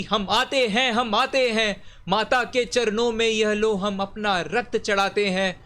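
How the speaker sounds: declaimed crowd's battle cry; mild rallying roar. A narrator's reading. Reciter voices the crowd offering its blood, mildly roused declamation. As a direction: answer the call as an eager crowd, within verse. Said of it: "सारी जनता हुंकार उठी".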